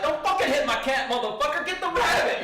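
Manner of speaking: loud, high, urgent order; heated reenacted scolding; angry yell. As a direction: shout at a friend to stop. Retold quarrel, yelling at Keith.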